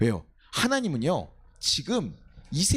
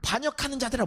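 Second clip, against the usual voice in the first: higher, indignant address; acted anger; 7 semitones higher than usual. Moses addressing the rebels, acted anger. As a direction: call out the rebels in mock anger. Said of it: "굉장히 분노해서 화를 내면서 승질을".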